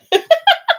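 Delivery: bright high-pitched giggle; high laugh. Real, not acted. Laughing after quoting friend.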